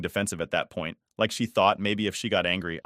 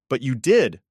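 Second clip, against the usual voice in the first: louder, quick retort fired straight back; sharp raised comeback; sharp shot back; 4 semitones higher, 6 dB louder than usual. Acted. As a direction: throw the words back at her, louder. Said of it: "I shot back".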